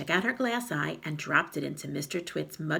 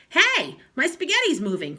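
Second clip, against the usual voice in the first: much higher, louder startled cry; husband spotting his food move, crying out; alarmed cry; 10 semitones higher, 9 dB louder than usual. A narrator's reading. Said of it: "cried Mister Twit".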